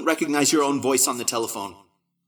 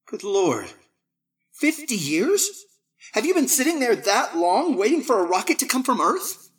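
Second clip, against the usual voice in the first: put-on a lightly higher young man's voice, incredulous; the young man on Mars, stunned at the date, lightly higher; young Barton; 12 semitones higher than usual. A narrator's reading.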